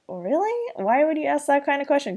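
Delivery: mock the silly question; incredulous. Viewer reacting to Cliff's Christmas question, high fast disbelief. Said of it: "I'm like".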